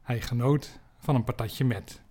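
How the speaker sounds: ordinary talk, nothing marked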